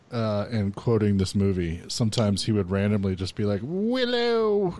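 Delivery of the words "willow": high bleating goat voice. Retold or imitated. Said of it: "like in the goat voice".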